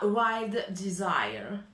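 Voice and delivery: put-on slow, drawn-out English accent on Wild Desire; playful fake English accent. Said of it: "j'aime faire cet accent".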